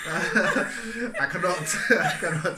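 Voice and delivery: laughing through the words, high and loud. Real, not acted. Laughing as she tells her friend she cannot read minds. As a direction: giggle it out, denying she reads minds. Laughs denying mind reading.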